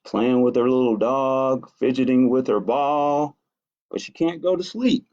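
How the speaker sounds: plain reading voice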